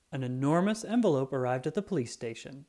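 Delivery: usual speaking voice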